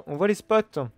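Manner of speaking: ordinary talk, nothing marked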